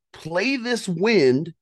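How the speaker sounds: pressing each word with urgency; tense excitement. Retold or imitated. Hunter planning to beat the wind to the bull, tense excitement.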